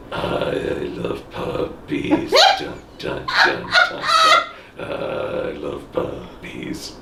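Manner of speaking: low, slow monotone tough guy; Vin Diesel voice. Pretend. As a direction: say it deadpan and badass, very slow. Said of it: "in the Vin Diesel voice, which means you just talk like a monotone".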